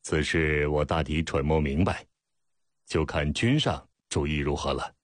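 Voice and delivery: usual speaking voice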